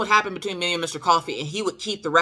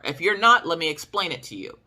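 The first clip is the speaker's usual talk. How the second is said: ordinary talk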